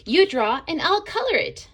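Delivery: high, loud eager offer; an excited paintbrush planning. A narrator's reading. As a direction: bubble over with excitement. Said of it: "She was excited too".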